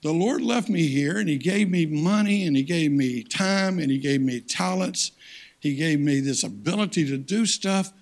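loud, punching each gift; loud preacher's list; full-force preaching. Acted. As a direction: build it up, gift by gift.

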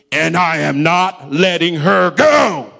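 slow, shouted demonic defiance; devil's voice. Retold or imitated. Devil refusing the pastor, slow booming shout. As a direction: roar it out slowly, rising on the last word. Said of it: "a voice said to me".

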